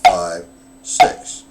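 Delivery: loud, punched count over the drumming. Shown as a demonstration. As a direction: call each count like a drill instructor. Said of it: "the double paradiddle's sticky".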